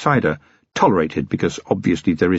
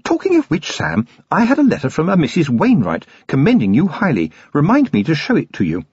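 bright, high society lady; cheerfully steering the talk away; 7 semitones higher than usual. A narrator's reading. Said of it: "said brightly".

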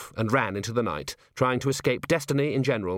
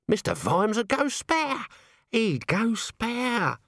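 high, drawn-out wail of dread; aghast; 12 semitones higher, slower than usual. Acted. Nobby imagines Vimes's fury, wailing in dread. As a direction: stretch it out in comic alarm.

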